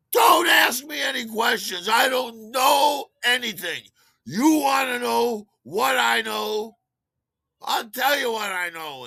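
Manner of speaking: high, loud shouted rant, slowing down; a fed-up bartender yelling his grievance; upset rant. Retold or imitated. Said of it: "he's upset about something".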